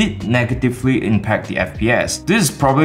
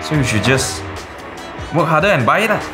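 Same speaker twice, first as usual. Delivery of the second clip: Singlish; 3 semitones higher than usual. Acted. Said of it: "bangsawan like me".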